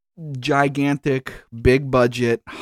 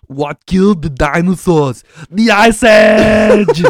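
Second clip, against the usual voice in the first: loud, slow, higher hammed-up Arnold shout; Arnold impression; 8 semitones higher, 11 dB louder, slower than usual. Shown as a demonstration. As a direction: belt it like a cartoonish Freeze. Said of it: "you just blow me out of the water".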